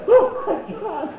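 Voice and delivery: high, drawn-out mock dismay; mock horror. Kid witnessing dad's road rage, high mock horror. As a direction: squeal it in acted horror. Child mortified by dad's yelling.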